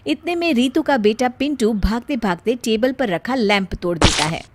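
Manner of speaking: ordinary delivery, nothing marked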